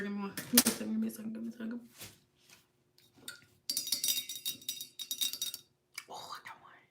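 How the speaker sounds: very soft, low thinking aloud; muttering to herself. Real, not acted. Streamer stalling viewers while recalling a story, muttering. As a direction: mumble it under your breath as you search your memory.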